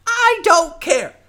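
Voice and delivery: put-on a bratty goblin kid digging in; defiant young goblin.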